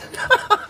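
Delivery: short breathy laugh; amused laugh. Real, not acted. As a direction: laugh at the comment. Laughs at a viewer's comment.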